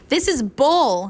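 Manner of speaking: slow, loud angry outburst; a man boiling over at bad news; angry yell. A narrator's reading. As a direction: spit it out slowly, furious. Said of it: "Hank yelled".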